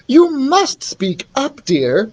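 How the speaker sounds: high, slow queenly instruction; chiding queen. Acted. Queen tells mumbling son, fondly chiding. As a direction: chide gently in a high, slow voice.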